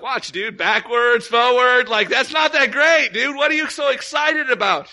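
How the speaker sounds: high, loud disbelief; can't believe the fuss over a fall; baffled friend shouting; incredulous. Retold or imitated. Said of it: "And I'm like".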